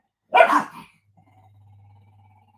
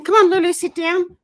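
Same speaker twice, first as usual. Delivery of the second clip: high, loud call to the dog; firm high command to settle Lulu; 9 semitones higher, 8 dB louder than usual. Real, not acted. To her excited dog Lulu, a high loud call.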